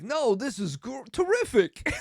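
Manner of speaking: high, loud, halting celebrity impression; hammed-up star, delighted and emphatic; Christopher Walken. Movie star reassuring shoe store worker, hammed-up impression. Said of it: "he's like".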